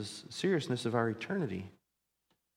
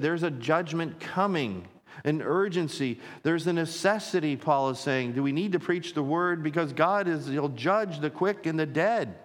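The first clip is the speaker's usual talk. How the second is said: raised, higher pulpit voice; urgent preaching; 5 semitones higher, 5 dB louder than usual. Real, not acted. Pastor warning of a judgment coming, urgent preaching. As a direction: press the warning on the congregation with urgency.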